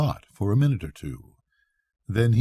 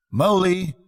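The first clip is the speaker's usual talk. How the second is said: high, louder cheerful shout; cheerful call; 9 semitones higher, 4 dB louder than usual. Acted. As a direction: hail a lost friend brightly through the wood. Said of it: "calling out cheerfully".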